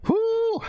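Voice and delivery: high, loud whoo of delight; loud whoop. Shown as a demonstration. Teacher replaying his favorite sound, a loud whoop. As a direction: whoop it out with joy. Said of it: "Listen to it again".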